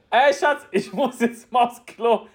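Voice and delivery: high, sing-out call to a partner; playful announcement. Retold or imitated. Acts announcing toilet trip to wife. (hoch und unbekümmert angekündigt)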